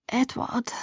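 weak, soft murmur of a name; faint first attempt. A narrator's reading. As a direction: barely get the name out, softly. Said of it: "meine Stimme wurde etwas klarer". (schwach und leise, kaum hörbar)

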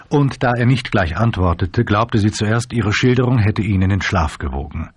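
usual speaking voice

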